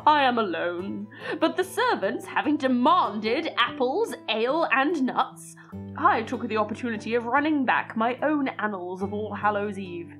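put-on deliberate, theatrical narration of an old letter; dramatic letter reading. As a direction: read it like an old diary entry, savouring words. Reads old letter over music.

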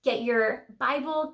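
usual speaking voice

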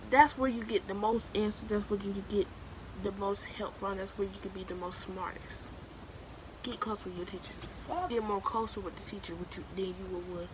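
ordinary talk

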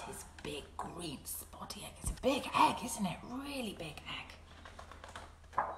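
soft, drawn-out whisper. Acted. Teacher shows the big egg to children, whisper. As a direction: hush it like a secret.

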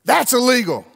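preacher voicing the stranger's joke; street man voice. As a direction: pop it out as the man at the window. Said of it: "suddenly he goes".

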